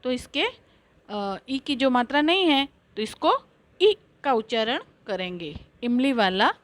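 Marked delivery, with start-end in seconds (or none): none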